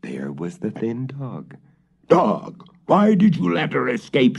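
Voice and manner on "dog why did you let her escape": higher, louder angry yell; witch shouting. Acted. The witch shouts at the dog, high and angry. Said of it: "shouted the witch".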